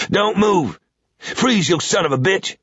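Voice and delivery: put-on a sudden high, barked order from an old southerner; shouted southern command. A narrator's reading. Old man with a revolver yelling at the intruder, high shout. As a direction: startle him with a yelled freeze. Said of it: "there was a shout".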